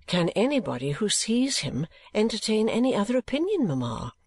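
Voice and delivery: put-on a pitying young lady; Kate's voice. Kate speaks.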